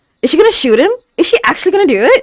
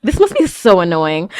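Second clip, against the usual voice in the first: normal talking voice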